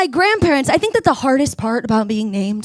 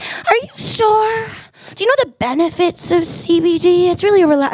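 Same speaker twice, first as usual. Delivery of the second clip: pushy barista; 4 semitones higher than usual. Retold or imitated. Stella pressing the CBD benefits, high and pushy. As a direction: lean on her, sweetly insistent. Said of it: "And she's like".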